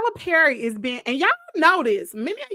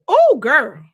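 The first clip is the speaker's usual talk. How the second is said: loud exclamation; 2 semitones higher, 9 dB louder than usual. Real, not acted.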